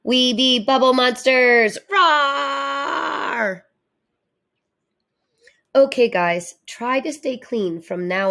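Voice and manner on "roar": playful shouted roar; pretend monster roar. Kids playing bubble monsters, mock roar.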